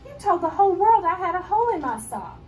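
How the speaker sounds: put-on high, loud outraged child; indignant accusation. A narrator's reading. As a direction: scold her for spilling the secret. Angry friend accuses in story.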